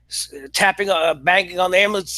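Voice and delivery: usual speaking voice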